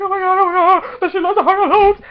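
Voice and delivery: high, loud excited gasping out; frantic fangirl gush; freaking out. Retold or imitated. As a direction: blurt it in giddy disbelief. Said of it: "I'm, like, freaking out".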